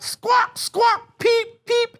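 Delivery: very high squawks and peeps; high bird squawk; penguin calls. Acted. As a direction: call back like a penguin parent. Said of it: "Roy and Silo called back".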